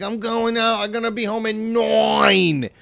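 high, exaggerated Long Island voice; Long Island accent. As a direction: stretch "nine" like a mocked Long Islander. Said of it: "Long Island accent".

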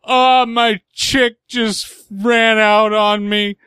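high, slow, teary retelling; crying voice. Retold or imitated. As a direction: imitate a dad crying on the phone. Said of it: "I'm trying to do crying voice".

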